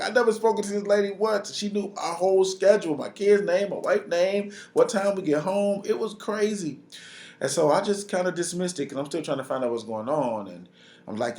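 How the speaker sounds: normal talking voice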